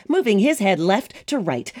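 usual speaking voice

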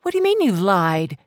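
high, loud indignant question; angry demand. Acted. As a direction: throw the word back at him. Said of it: "Mary was angry now".

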